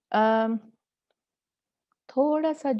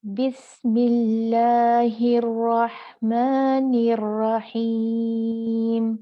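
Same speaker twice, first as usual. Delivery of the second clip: slow, drawn-out chanted recitation; Quran recitation; 5 dB louder, much slower than usual. Shown as a demonstration. Teacher reciting the example for her students, slow chanted recitation. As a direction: recite it slowly with full tajweed. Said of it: "चलें पढ़ते हैं।".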